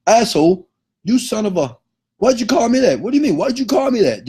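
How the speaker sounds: high-pitched, indignant mock outburst; fly off the handle, voice shooting up; acted upset outrage. Insulted person snapping back at name-caller, acted outrage. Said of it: "you immediately get upset".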